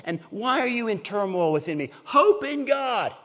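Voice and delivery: loud, emphatic self-exhortation; rally your own soul out loud; talking to himself. Acted. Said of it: "he's talking to himself".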